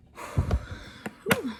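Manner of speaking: long breathy whew of exhaustion; tired sigh. Real, not acted. Heavily pregnant vlogger sighing to her viewers, worn out. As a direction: blow out the air like a worn-out mum-to-be.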